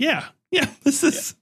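high-pitched, giggly agreement; laughing high. Retold or imitated. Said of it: "And we were like".

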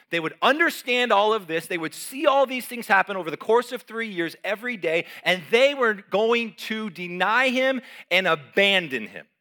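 full-force sermon climax; impassioned preaching. Acted. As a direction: build to a fervent peak. Preaching climax on disciples' denial.

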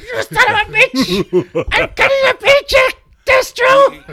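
very high, loud screeching villain; Cobra Commander. Acted. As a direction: shriek it in drawn-out cartoon rage. Imitating Cobra Commander raging at Destro.

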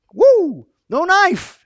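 high, loud whoop of delight; excited whoop. Real, not acted. Preacher cheering David's bare-handed fight, high excited whoop.

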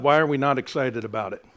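ordinary talk

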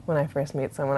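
plain reading voice

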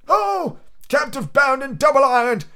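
high anguished cry of the ghost. Acted. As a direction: wail it out in torment. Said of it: "cried the phantom".

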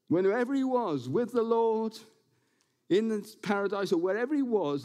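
ordinary delivery

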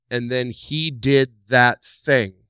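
sulky, slow grievance; mock whine; slow annoyed complaint. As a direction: drag it out slowly.

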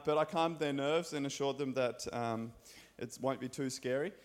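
ordinary talk, nothing marked

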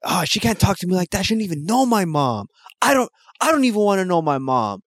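put-on high, fast indignant boyfriend; angry boyfriend. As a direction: rant about his mom. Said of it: "the boyfriend's like".

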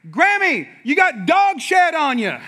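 calling out loud for the whole park to hear; loud announcement. Retold or imitated. Son announcing to the whole amusement park, loud and high. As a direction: blurt it out high and loud, kid style. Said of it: "repeats ... out loud to the whole".

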